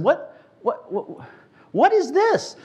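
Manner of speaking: high, loud, bewildered asking; excited question. Retold or imitated. Young believer asking the leader, high and excited. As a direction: squeal it out in amazement. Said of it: "I said".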